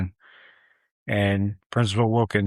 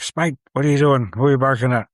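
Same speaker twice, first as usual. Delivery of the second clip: louder, higher mock scolding; neighbor hollering; 5 semitones higher, 5 dB louder, faster than usual. Neighbor to his yapping dog, hollering. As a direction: imitate an owner calling off a dog.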